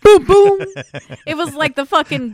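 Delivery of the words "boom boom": loud booming knock sounds; loud mock knocking. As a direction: bang it out like a gavel.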